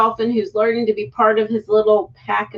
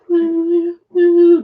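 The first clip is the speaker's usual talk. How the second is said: luring fish noise; 6 semitones higher, 4 dB louder than usual. Retold or imitated. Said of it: "the one that's like".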